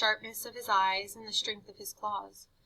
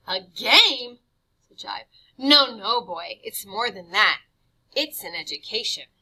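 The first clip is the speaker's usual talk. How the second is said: sly mentor; 11 dB louder than usual. Acted. Jive correcting Harvey.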